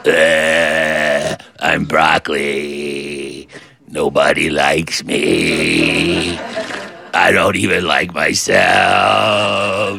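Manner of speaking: dejected broccoli moping in a low slow drone; gloomy broccoli. Pretend. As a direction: sulk out each word like nobody loves you. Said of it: "It's like".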